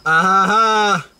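slow, high, loud "ah ha ha"; mock laugh. Answering a friend's crude tease, slow mock laughing.

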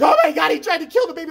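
high, loud mock-panicked mother with a laugh; panicked mom. Mom shrieking at her son over the baby, hammed-up panic.

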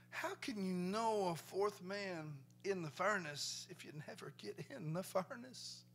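ordinary talk, nothing marked

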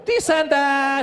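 slow, evasive "this and that"; vague drawn-out dodge. Retold or imitated. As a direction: stall the answer, dragging it out.

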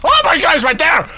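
high, loud startled shout; startled panic. Real, not acted. Player spotting the monster right there, startled panicked shout. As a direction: cry out in sudden alarm. Monster suddenly appears in game.

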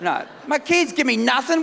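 usual speaking voice

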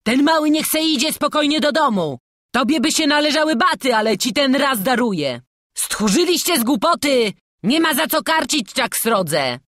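loud, high pleading bluster; urgent, magnanimous bargaining; cajoling prisoner. A narrator's reading. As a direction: talk the boys into releasing him.

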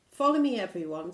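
loud, quick command; calling out orders. Acted. Captain leading everyone into jungle, calling out. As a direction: call the crew to follow.

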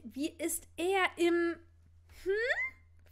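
slow suggestive question ending in a high hm; eager whisper. A narrator's reading. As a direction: tease her friend with a slow, knowing hm. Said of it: "flüsterte Ginny gespannt". (neckisch gedehnt gefragt)

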